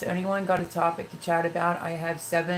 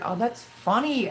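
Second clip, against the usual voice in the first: ordinary talk, nothing marked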